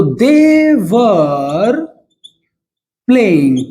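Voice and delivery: they were, long pause, playing; slow teaching example; slow model sentence. Shown as a demonstration. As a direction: drag it out slowly for learners to follow. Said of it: "वे लोग खेल रहे थे".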